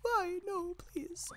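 high, put-on weepy whine; hammed-up tearful pleading; fake crying. Shown as a demonstration. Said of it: "do, like, the fake crying".

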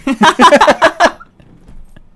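high squealing burst of laughter; loud squealing laugh. Real, not acted. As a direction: shriek with laughter. Laughing at friend's line.

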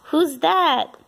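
ordinary talk

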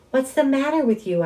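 high, loud angry yell. A narrator's reading. Son yelling at his mom for being rude, high and loud. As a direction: yell it at her, outraged. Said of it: "I yelled".

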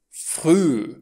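"früh" with a long rounded ü, silent h; long vowel. Shown as a demonstration. Teacher shows H lengthening the vowel, "früh" with drawn-out ü. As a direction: stretch "früh" into "früüü". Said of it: "această vocală este lungă".

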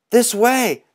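high, loud call across the ravine; yell. Acted. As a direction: shout to guide him over. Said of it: "they all yelled".

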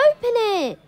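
excited animals begging him; eager animals. A narrator's reading. Animals urging George.

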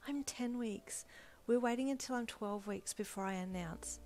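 whispered secret to a friend; confiding whisper. A narrator's reading. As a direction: whisper it so nobody else hears. Hushed confiding of secret pregnancy.

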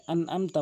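আনআমতা with plain vowel for ain; quick light আনআমতা; common mispronunciation. Shown as a demonstration. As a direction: say আনআমতা with no throat squeeze. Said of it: "আনআমতা বলে".

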